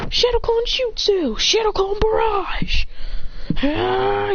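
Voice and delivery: high, drawn-out anime battle cries; attack call. Acted. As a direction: stretch each move name out high. Character calls out attacks.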